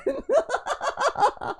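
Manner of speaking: high-pitched burst of laughter; real, squealing giggle fit; laughing. Real, not acted. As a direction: crack up at yourself. Laughs at own accent slip.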